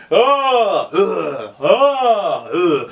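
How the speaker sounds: hammed-up fighting noises; mock struggling cries. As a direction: yelp and grunt as if fighting it off. Acting men fending off big shark.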